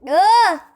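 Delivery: short, sharp scared yelp; a jolt of real fright; startled scream. Real, not acted. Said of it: "I'm scared".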